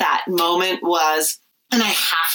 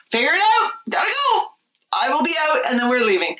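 high, chirpy mock carefree goodbye; mock cheerful exit; breezy sing-song exit; 8 semitones higher, faster than usual. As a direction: sing it out as you flee the room. Mom escaping to her room.

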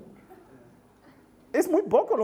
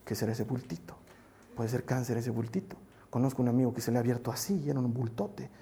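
low, hushed, hurried fretting; mock-anxious muttering to yourself; worried complaining; 8 semitones lower, 8 dB quieter, faster than usual. Pastor imitating someone fretting over a lump, low and worried. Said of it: "En lugar de estarte quejando".

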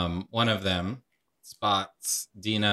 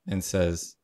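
ordinary delivery

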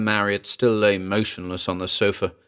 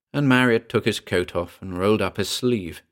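plain reading voice

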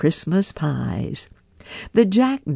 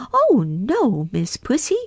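higher little mouse voices; mice answering; 9 semitones higher than usual. A narrator's reading. Mice refusing Pussy, higher. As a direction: squeak it back at the cat. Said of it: "Three little mice sat down to spin".

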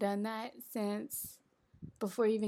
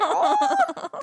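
mock surprise; 9 dB louder than usual. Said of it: "I was like".

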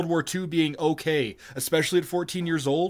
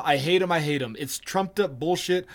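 ordinary talk

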